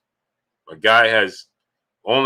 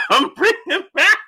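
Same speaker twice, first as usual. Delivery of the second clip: laughing. Real, not acted. Laughs through the words.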